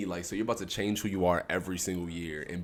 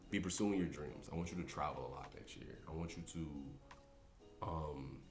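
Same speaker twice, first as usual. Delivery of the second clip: soft, halting, near tears; holding back tears; 2 semitones lower, 9 dB quieter than usual. Real, not acted. Host giving himself a message for next year, near tears. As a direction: speak quietly, fighting the urge to cry. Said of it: "I'm trying not to cry".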